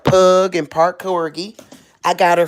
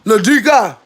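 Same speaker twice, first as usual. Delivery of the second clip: loud, higher motherly summons; mama voice; 5 semitones higher, 6 dB louder than usual. Real, not acted. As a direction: call her sharply like mama would. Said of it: "my mama voice".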